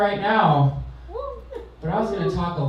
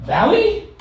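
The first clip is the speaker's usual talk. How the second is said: mock surprise; 6 dB louder than usual. Mock reply to Valley girl.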